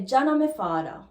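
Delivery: Jamaican patois with "father" as "fada". Shown as a demonstration. Teacher offers another word order, in Jamaican patois. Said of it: "You can also say".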